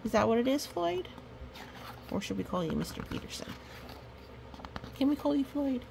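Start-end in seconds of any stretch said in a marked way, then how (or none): none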